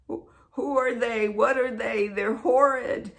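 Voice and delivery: reliving alarmed disgust; horrified. Retold or imitated. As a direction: stammer into appalled questions. Acted horror at human beings.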